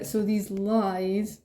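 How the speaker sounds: ordinary talk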